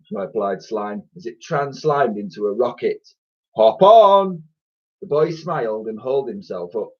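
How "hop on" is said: high, loud eager call. A narrator's reading. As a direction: urge him aboard. Slime calls to Ned.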